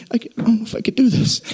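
high, fast, strained plea of a man fading; weak whiny complaint. Retold or imitated. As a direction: whimper as if he can barely go on. Dying from sugar withdrawal fast.

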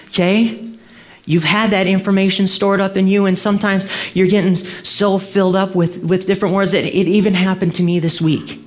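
fervent preaching. Acted. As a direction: rouse the congregation. Preaches at full force.

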